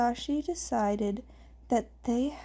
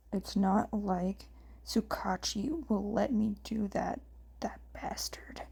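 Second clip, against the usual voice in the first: whispered grumble under the breath; grumbling under breath; 3 semitones lower, 6 dB quieter than usual. A narrator's reading. Shota grumbles to himself about Tsukachi in a whisper. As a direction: mutter it to yourself, barely voiced. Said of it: "Shota grumbling under his breath".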